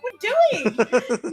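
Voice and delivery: slow, laughing mock reproach; laughing disbelief. Asking her friend why he wants to join the wedding, laughing. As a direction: drag it out while laughing at him. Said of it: "I'm like".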